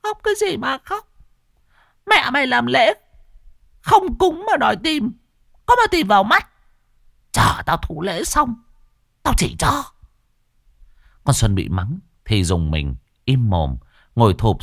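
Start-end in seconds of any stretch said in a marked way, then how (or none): 0.0-9.8 put-on very high, eerie spirit snapping at the girl; possessed spirit berates the crying girl, shrill and angry; ghost scolding. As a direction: scold her like an arrogant ghost. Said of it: "chỉ tay vào mặt con Xuân".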